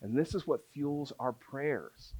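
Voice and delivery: soft, measured preaching refrain; quiet earnest pulpit close; solemn sermon appeal. Acted. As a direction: lay out each need gravely, then land the refrain.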